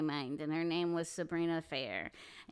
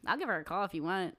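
ordinary talk

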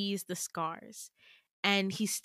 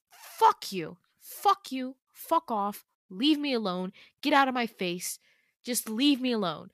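louder, separate angry bursts; angry outburst; 3 semitones higher, 4 dB louder than usual. Acted. Girl shoving Damien away after his concern, angry outburst. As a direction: fire off each dismissal on its own. Acted climax of the quarrel.

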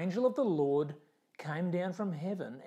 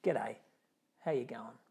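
low, casual Australian greeting; light Australian accent; 6 semitones lower, 4 dB quieter than usual. Retold or imitated. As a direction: greet them like a laid-back Aussie. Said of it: "literally in Australian".

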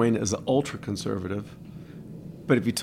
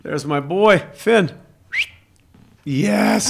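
excited dog greeting; 9 semitones higher, 8 dB louder, much slower than usual. Real, not acted.

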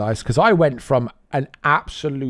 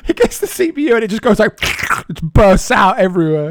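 laughing through the words; giggling, high and fast; 7 semitones higher, 5 dB louder than usual. Real, not acted. Laughing retelling a funny video.

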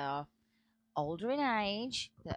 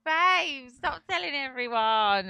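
mock protest; 7 semitones higher, 10 dB louder, slower than usual. Protesting his joke about crush.

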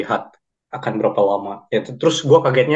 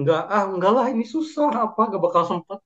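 higher, whiny refusal, lightly mocked; imitating a reluctant friend; 9 semitones higher than usual. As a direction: brush off the offer like a lazy classmate. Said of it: "gua nanya sama Uhin".

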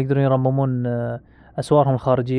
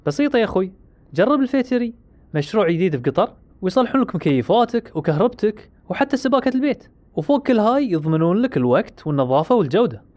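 ad read; 5 semitones higher than usual. Acted. Reads sponsor ad in skit.

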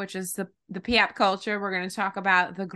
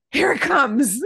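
excited; 3 semitones higher, 5 dB louder, slower than usual. Retold or imitated. Excited about spring weather.

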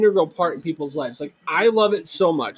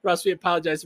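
ordinary delivery

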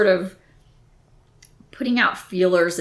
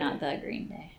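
unvoiced whisper, much softer; whispered aside; 3 semitones lower, 13 dB quieter than usual. Real, not acted. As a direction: whisper the correction like a secret. Correcting the band name aside.

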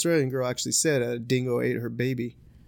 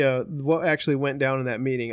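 ordinary talk, nothing marked

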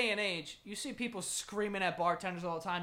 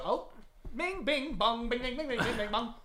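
sung piano plinks, higher; imitating piano; 4 semitones higher than usual. Mimicking the saloon piano during a shootout, sung plinks. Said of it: "he starts to go".

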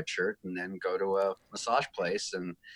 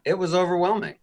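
laughing; 10 semitones higher, 6 dB louder, faster than usual. Real, not acted. Laughing about Grammy night.

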